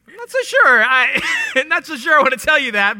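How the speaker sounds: a teasing Jesus, laughing as he dodges; laughing reluctance. Retold or imitated. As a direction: giggle out a playful refusal. Jesus joking, reluctant to answer.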